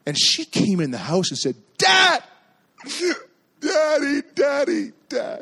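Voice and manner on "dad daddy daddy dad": high, mock-distressed cries for help; imitated screaming child. Daughter running home to Dad, screaming, imitated. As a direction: wail for Dad like a hurt little kid. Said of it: "comes running home screaming".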